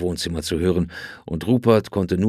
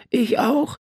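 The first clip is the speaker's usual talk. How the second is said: put-on calling boy. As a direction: pipe up again, insistent. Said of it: "rief sein Bruder".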